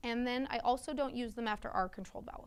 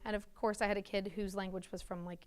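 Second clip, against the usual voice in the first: normal talking voice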